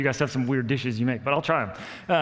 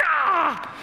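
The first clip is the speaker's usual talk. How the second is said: frustrated cry; 4 dB louder than usual. Retold or imitated. Elk left before he came.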